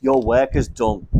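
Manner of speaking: ordinary talk, nothing marked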